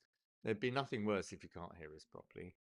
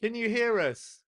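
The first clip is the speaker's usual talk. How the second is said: high, loud and slow sound check; raised, stretched-out question; calling out; 11 semitones higher, 12 dB louder, slower than usual. Real, not acted. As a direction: holler it as if to someone far away. Checking viewers can hear.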